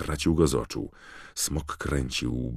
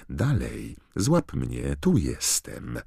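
hissed taunt; 8 semitones higher, slower than usual. A narrator's reading. Harry goading the dragon to catch him, taunting. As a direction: goad with a high, contained voice. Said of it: "syknął Harry, krążąc nad smokiem i drażniąc go".

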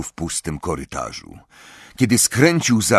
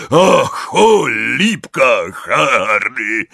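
loud, slow, winded exclamation; big breathless giant voice; panting; 4 semitones higher, 5 dB louder, much slower than usual. A narrator's reading. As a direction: gasp it out heavily. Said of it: "wydyszał Hagrid".

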